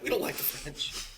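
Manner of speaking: furtive whisper, as if not allowed; hushed whisper. Real, not acted. Co-host unsure he can still say it, whispering. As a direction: whisper it under your breath. Wary if it may be said.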